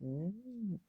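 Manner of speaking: normal talking voice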